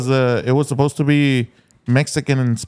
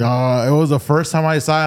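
usual speaking voice

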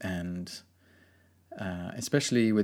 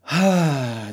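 big audible sigh; loud sigh; 7 semitones higher, 9 dB louder than usual. Real, not acted. Deep breath before speaking.

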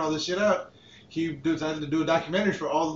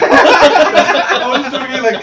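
loud, high words through real laughter; laughing hard; 9 semitones higher, 14 dB louder, slower than usual. Real, not acted. Defending where he pointed, laughing hard. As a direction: crack up while protesting. Cracking up over his pointing.

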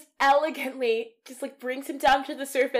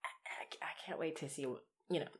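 barely voiced, much softer whisper; hushed whisper; 6 semitones lower, 18 dB quieter, faster than usual. Real, not acted. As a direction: breathe it out as a whisper. Moved, hushed about the film.

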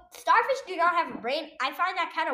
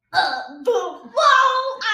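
loud rising whoa, ah; mock yell; 9 semitones higher, 9 dB louder, much slower than usual. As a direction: shout it out as you get hit. Acting being hit by coconut.

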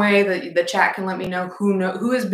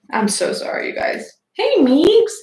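usual speaking voice

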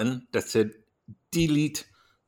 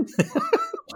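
high-pitched real laughing fit. Real, not acted. Laughing after quoting a movie line, high real laughter. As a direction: burst out laughing helplessly.

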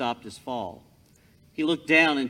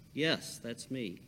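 calm God voice; 3 semitones lower, 8 dB quieter than usual. Acted. God answers Jack calmly.

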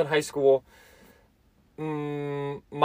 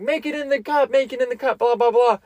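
retold yelling; 7 semitones higher than usual. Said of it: "everyone's yelling at you".

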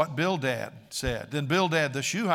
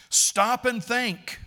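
raised, slow, forceful admonition; high, loud and deliberate; emphatic admonishing; 8 semitones higher, 6 dB louder, slower than usual. Acted. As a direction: preach it as a stern challenge. Preacher voices Eliphaz confronting Job.

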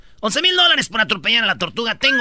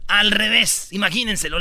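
ordinary delivery

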